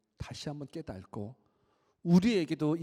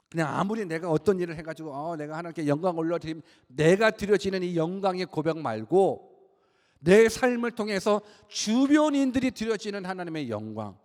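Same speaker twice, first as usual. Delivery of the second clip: raised, loud sermon voice; impassioned preaching; 7 semitones higher, 9 dB louder than usual. Acted. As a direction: press the point home to the congregation. Preaches with rising force.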